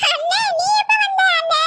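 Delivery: high-pitched, emotional greeting; overjoyed surprise. Acted. Sister greets brother just back from abroad, overjoyed surprise. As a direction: greet him overwhelmed, pitch lifted high.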